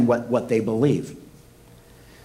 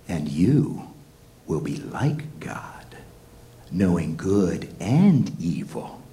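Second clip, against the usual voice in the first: much lower, slow, whispered tempter; Satan's voice; 8 semitones lower, slower than usual. Acted. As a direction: lure her in a low creeping whisper. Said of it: "And Satan says".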